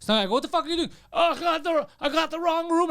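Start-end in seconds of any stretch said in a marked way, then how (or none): 0.3-0.9 high-pitched indignant demand; acted-out outrage; retold angry challenge. Acted confrontation with hotel intruder. 1.1-2.9 high, flustered excuse; mock panic, pitched up; imitating panicked intruder. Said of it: "the guy panics".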